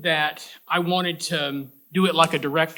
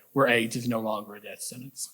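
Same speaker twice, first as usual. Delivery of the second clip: normal talking voice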